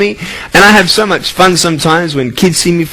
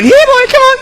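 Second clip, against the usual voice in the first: much higher coaxing dog call. Retold or imitated. Speaker calling a phone like a dog, high coaxing. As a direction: coax it like calling a puppy. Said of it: "say to the phone".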